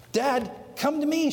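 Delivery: a lightly voiced scared child begging her father; frightened little girl. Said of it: "she said".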